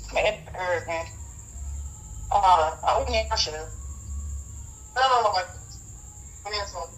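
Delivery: usual speaking voice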